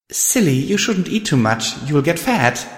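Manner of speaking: put-on high small girl's voice; light, high little pony voice; tiny filly voice. A narrator's reading. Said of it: "a tiny voice said".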